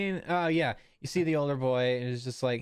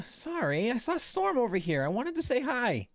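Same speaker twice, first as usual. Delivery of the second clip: put-on high-pitched kid apologizing; high, eager ten-year-old; young child; 13 semitones higher than usual. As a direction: excuse yourself to big brother in a little boy's voice. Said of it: "The first kid was maybe, like, ten".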